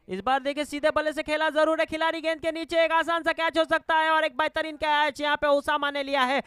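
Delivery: high, loud wicket call; excited cricket commentary. Real, not acted. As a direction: call the catch with rising excitement.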